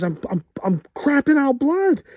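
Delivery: very high-pitched hammed-up panic; freaking out. Telling his wife what is wrong, freaking out. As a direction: stammer into a high, loud panicked cry. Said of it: "I'm freaking out".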